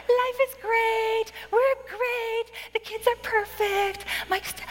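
high, slow, sugary perfect-family brag; fake cheerful Christmas card. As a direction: gush like everything is perfect. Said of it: "You don't air your dirty laundry".

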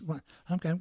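usual speaking voice

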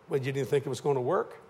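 ordinary talk

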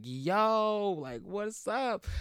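high, drawn-out come-on; flirty greeting. Acted. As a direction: stretch it out like a smooth hello.

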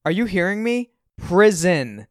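loud, high "Prison", short of yelling; insistent question, then "Prison" punched out; emphasis on Prison. A narrator's reading. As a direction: hit "Prison" hard on its own. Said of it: "I pronounce the word with emphasis".

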